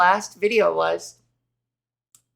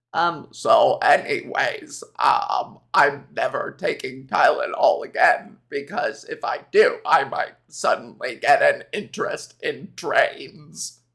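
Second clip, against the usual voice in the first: RFK impression; 6 semitones lower than usual. Vlogger imitates RFK for her viewers, low strained croak. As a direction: rasp it out like a voice that sounds like a broken leaf blower.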